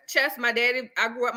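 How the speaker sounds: usual speaking voice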